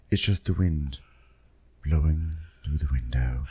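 whispered like drifting wind; airy wind whisper. Pretend. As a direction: breathe it out like a breeze. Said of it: "That's my wind voice".

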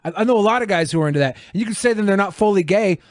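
ordinary talk, nothing marked

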